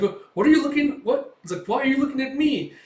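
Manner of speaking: high, loud, squeaky questions; flustered sidekick. Retold or imitated. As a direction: demand to know why you're stared at. Sidekick flustered by girl's gaze.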